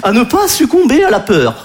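high, slow mock presidential appeal; class-rep president. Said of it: "sa tête de délégué de la classe". (président façon délégué de classe, aigu et solennel)

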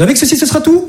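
high sing-song shopkeeper question; mock baker's phrase. Comedian mimicking the baker's sing-song phrase.

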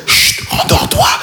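hushed high whisper, slow. Retold or imitated. Roommate caught sneaking in at 3am, whispering to hush me. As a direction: shush him back to sleep in a whisper.

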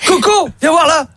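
high, slow call to the parrot; calling a pet bird from another room. Acted. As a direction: sing out the bird's name sweetly. Owner calls the parrot.

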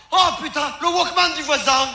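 mock annoyed cry at the neighbour; exasperated outburst. Comedian replaying his reaction to neighbour's music, exasperated.

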